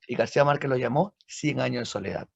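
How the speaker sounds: ordinary delivery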